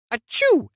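loud playful fake sneeze. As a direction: sneeze it out as a joke. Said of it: "she would turn to me and go".

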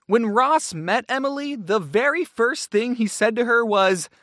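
ordinary talk, nothing marked

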